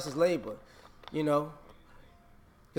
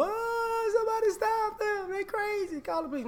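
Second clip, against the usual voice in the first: much higher, hammed-up cry for help; mock panicked victim. Mocking someone who starts beef and then begs for police, much higher. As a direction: squeal for help like a fake victim. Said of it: "and then you go".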